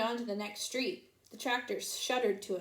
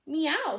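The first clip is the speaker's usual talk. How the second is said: cat meow; 6 semitones higher, 5 dB louder than usual. A narrator's reading. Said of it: "said Cat".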